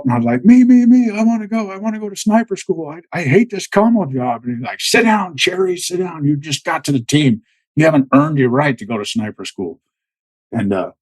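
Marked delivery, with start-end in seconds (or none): 0.5-4.4 eager volunteering. Retold or imitated. Soldier begging the team sergeant for sniper school, eager high plea. 4.8-9.8 imitated sergeant's rebuke, high then settling; sergeant's put-down. Team sergeant telling the new guy to sit, mock scolding.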